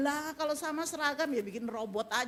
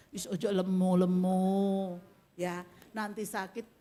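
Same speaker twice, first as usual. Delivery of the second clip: lower, slower, kind "Wis ojo lemu-lemu"; gentle caring warning; 8 semitones lower than usual. Shown as a demonstration. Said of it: "Tapi cara penyampaiannya". (teguran lembut penuh kasih)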